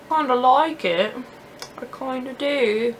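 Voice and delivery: put-on a deliberately horrible British accent; bad British accent. Vlogger joking to viewers in a bad British accent. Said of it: "my horrible impression of a British accent".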